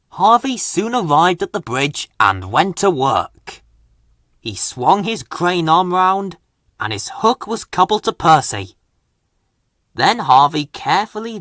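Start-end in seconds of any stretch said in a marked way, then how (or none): none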